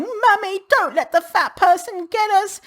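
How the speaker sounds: high, hammed-up child's plea; mock crying child. Imagined thin child begging mummy in a mock high plea. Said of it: "a thin child crying".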